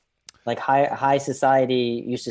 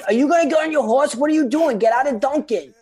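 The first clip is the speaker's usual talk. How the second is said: put-on high, loud, rapid-fire Boston accent; modern Boston accent; 14 semitones higher, 5 dB louder than usual. Colonist hurrying Paul onto his horse, loud Boston accent. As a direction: harangue him in a mock Boston accent. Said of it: "speaking in modern-day Boston accents".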